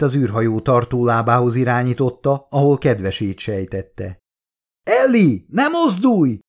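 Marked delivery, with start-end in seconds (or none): 4.8-6.4 high yell over the storm; yelling into helmet mic. Acted. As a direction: shout to her to stay put. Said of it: "üvöltötte a sisak mikrofonjába". (magas hangú üvöltés a sisakmikrofonba)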